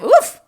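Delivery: loud, high yelping woof. A narrator's reading. A bean strutting, bark a loud high woof. Mock swagger exclamation.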